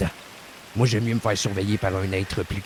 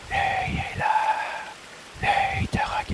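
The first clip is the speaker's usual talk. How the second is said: breathless voice-in-the-head whisper; ghostly whisper; slower than usual. Retold or imitated. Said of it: "J'ai entendu dans ma voix".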